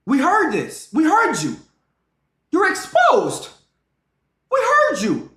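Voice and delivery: loud, high, drawn-out call-out; shouting her down. Real, not acted. Streamer shouting at a girl caught lying in the clip. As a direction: yell it like you caught a liar.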